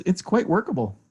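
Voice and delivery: usual speaking voice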